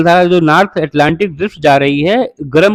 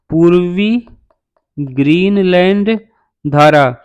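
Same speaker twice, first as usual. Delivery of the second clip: word by word, a pause after each; drawn-out words, clearly separated; slow dictation; much slower than usual. Shown as a demonstration. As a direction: dictate the name slowly for note-takers. Repeats name slowly for students.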